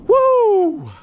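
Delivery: loud, high whoop; whooping at the catch; joyful whoop. Real, not acted. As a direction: let out a triumphant woo. Celebrating the catch.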